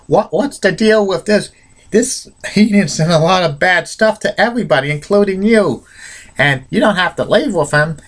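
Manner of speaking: high-pitched shocked protest, retold; Hogan, shocked. Retelling Hogan confronting Andre about his manager Heenan, shocked. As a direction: imitate an appalled objection, voice pitched well up. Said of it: "Hogan was very shocked, saying".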